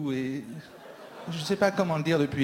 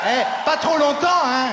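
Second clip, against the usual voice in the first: high loud call; 10 semitones higher, 10 dB louder than usual. Real, not acted. Comic crowd-surfing calling to the audience, loud and high. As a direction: shout it high over the crowd.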